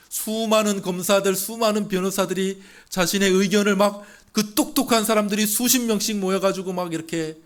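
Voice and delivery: loud, high, unbroken rush of clamour; full-force preaching. Real, not acted. (높고 큰 목소리로 몰아치는 열띤 설교)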